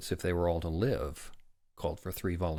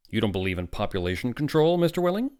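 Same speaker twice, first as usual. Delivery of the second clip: loud challenge climbing high at the end; severe; 5 semitones higher, 6 dB louder, faster than usual. A narrator's reading. Doctor towering over the father, severe. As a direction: challenge him sternly, voice rising. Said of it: "became rather severe with Welling".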